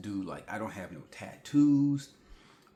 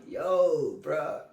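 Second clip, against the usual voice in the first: exasperated yo bruh; 5 semitones higher, 4 dB louder than usual. Retold or imitated. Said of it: "So I'm just like".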